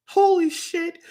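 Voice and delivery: high, strained holy shit; sudden high-pitched exclamation of shock; disbelieving cry. Real, not acted. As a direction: squeal it in disbelief. Disbelieving outburst.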